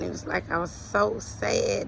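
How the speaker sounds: ordinary delivery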